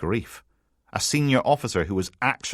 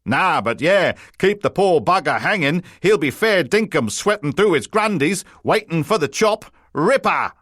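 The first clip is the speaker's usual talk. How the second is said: hammed-up Aussie accent, much higher and louder; a terrible mock Australian, laying it on thick; over-the-top fake Australian accent to taunt; bad Australian accent; 10 semitones higher, 6 dB louder than usual. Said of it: "put on an Australian accent so bad".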